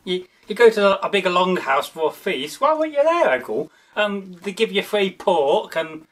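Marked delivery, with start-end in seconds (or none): 2.6-3.7 mock question. Imitating a relative's question.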